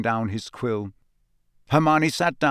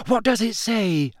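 breathless; 10 semitones higher than usual. Acted. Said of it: "Hermione asked breathlessly".

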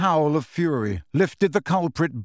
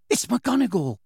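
ordinary delivery

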